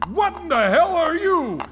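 loud, high cry of alarm; alarmed shout. Acted. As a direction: shout in startled fear. Confronted by a monster.

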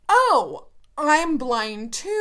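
loud, high, slow mock gushing; mock clueless stranger. Loud, slow mock gushing while mimicking a stranger who says she's blind too. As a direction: ham up the clueless stranger's claim. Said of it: "people will say".

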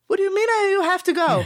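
mock the boss's weird broken accent; GM's broken accent. Cook imitating the GM for listeners, high broken accent. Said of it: "this is the way that our G M talks".

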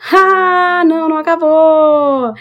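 a high, loud "no, it's not over!"; playful high exclamation; mock dramatic denial. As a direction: tease the listeners with a hammed-up twist. Teasing the audience about more trouble.

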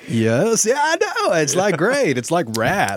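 swooping, hammed-up radical dude; rad radio voice. Shown as a demonstration. Host keeps up Radcast demo for friends, hammed-up rad voice. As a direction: swoop up high like a totally rad DJ. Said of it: "It's a radical radio voice".

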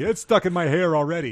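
put-on high, loud child's whine; complaining little kid. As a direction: wail it like a sticky toddler. Voicing little Tommy complaining.

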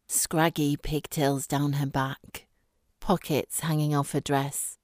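ordinary delivery

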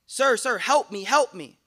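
desperate plea. Acted. Dreamer begging the shining old man to save him, desperate. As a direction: beg for rescue with the snake close behind. Fleeing man begs for help.

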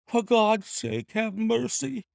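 terrified shriek for mercy; shrieking plea. A narrator's reading. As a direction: beg for your life, voice cracking high. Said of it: "he shrieked".

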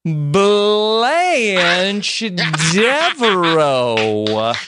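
Southern drawl that finally lands, laughing through it; thick Southern accent. Shown as a demonstration. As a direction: drag out Devereaux like a Southern belle.

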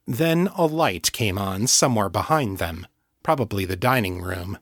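ordinary delivery

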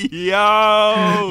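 hype shout. Pretend. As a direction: shout it out like a party hype man. Joins the hype voice.

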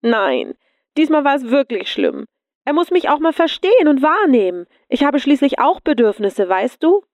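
high-pitched, wailing complaint; whining. A narrator's reading. Lea complaining to her friend about being ignored, whining. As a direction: pitch it right up and whine in protest. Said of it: "Lea entgegnete jammernd".

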